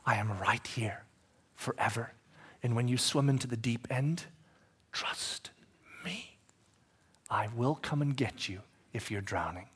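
soft, low reassurance; tender Jesus promise. Acted. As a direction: promise it quietly. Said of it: "Because that's right".

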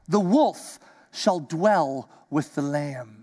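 low, slow, solemn verse; hushed slow reading; slow scripture reading. Acted. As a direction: savour each word of the verse. Reads verse slowly.

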